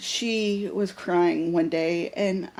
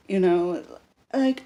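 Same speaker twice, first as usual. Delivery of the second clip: ordinary talk, nothing marked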